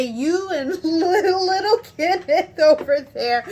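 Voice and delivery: high, loud, stumbling through laughter; laughing affection. Retold or imitated. Customer teasing supervisor about little Kenneth, laughing fondly. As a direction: giggle through it, gushing loudly. Breaking up while quoting herself.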